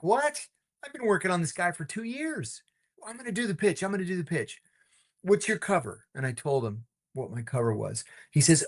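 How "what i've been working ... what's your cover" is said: high, disbelieving complaint; mock outrage at losing the pitch; indignant protest. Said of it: "I'm like".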